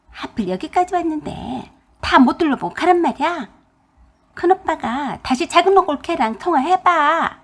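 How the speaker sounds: a hint of a put-out sister; annoyed sister-in-law. Sister complaining the trip is cut short, mildly sarcastic.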